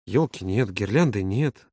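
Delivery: low, resigned listing; sighing. A narrator's reading. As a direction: count off what is missing with a sigh. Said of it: "Володя вздохнул". (со вздохом, разочарованно)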